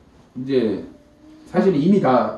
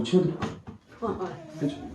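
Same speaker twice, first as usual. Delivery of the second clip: ordinary talk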